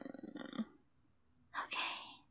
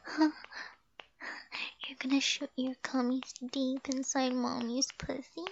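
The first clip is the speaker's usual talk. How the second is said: ordinary delivery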